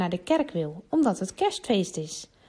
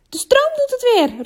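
much higher, louder excited shout; calling out; 8 dB louder than usual. A narrator's reading. Boy calling out as the lights come back, loud and high. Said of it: "roept Julian".

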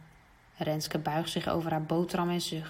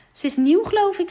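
a lightly voiced confident boy, louder; boastful boy; 11 semitones higher, 7 dB louder than usual. Jan bragging to classmates before the test, confident. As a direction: brag a little, sure of yourself.

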